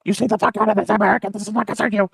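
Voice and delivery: hammed-up quarrel voice; mock-stern warning. North Korea warns America off.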